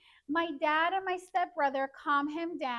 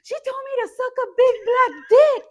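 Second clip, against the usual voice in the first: high, very loud tattling yell; Mikey shouting; 9 semitones higher, 13 dB louder than usual. Retold or imitated. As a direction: imitate him bellowing the complaint to dad. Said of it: "the fact that he was shouting it".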